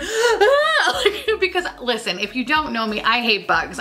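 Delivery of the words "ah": high, shrill-free laughing shriek of fright; startled squeal through laughter; mock scream. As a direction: yelp at a bug, half laughing. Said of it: "and I just went".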